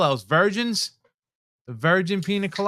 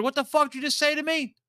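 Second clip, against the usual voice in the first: higher, incredulous challenge; a worker retelling how he snapped at a rude driver, higher and challenging; retold angry confrontation; 9 semitones higher than usual. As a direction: replay the moment he lost it. Repeats angry comeback.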